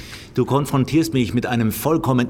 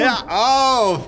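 high, loud exasperated cry; shout; 11 semitones higher, 4 dB louder than usual. Acted. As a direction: cut him off with a yell. Captor snaps at captive's puns.

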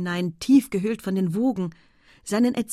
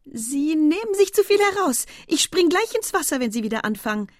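high, loud mock outrage easing off at the end; playful indignation; 9 semitones higher, 5 dB louder than usual. A narrator's reading. Estelle threatens to jump in the water, mock indignant. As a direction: scold him, only playing offended. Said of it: "spielte ein bisschen die Entrüstete".

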